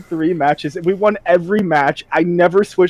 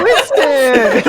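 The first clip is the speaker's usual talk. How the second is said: higher, laughing shout of the name; mock excited call; 10 semitones higher, 3 dB louder than usual. High teammate greeting Winston, laughing high call. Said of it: "he's like".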